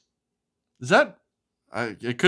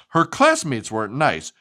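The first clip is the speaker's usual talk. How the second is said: lively, sing-song storybook reading; animated picture-book narration; reading to children; 3 dB louder than usual. Acted. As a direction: read it like bedtime story time for a child.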